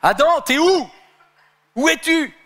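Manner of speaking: high, loud call searching the garden; God calling Adam in the garden, high and loud. Retold or imitated. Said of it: "Dieu lui dit".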